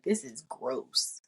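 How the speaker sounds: low, slow disgust; retold disgust. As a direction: say it slowly, dropping low, as if appalled. Retold disgust after a trip.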